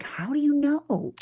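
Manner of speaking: high, slow, puzzled retelling; mock surprise. Answering someone who guessed her home state, acted surprise. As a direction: draw it out high, as if baffled. Retold surprise at being recognised.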